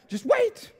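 "wait" thrown up high; exasperated, high-pitched "just WAIT"; impatient command. Shown as a demonstration. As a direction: demo of a curt order to wait. Acts out impatient American "wait.

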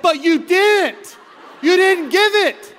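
slow, high, loud disbelief; exasperated outburst. Retold or imitated. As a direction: stretch it out in mock frustration. Frustrated at associate pastor.

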